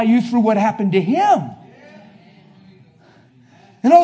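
loud, high pulpit preaching; preaching full force. Real, not acted. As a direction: preach it to the room.

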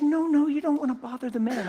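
mother's protest. Retold or imitated. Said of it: "And she said".

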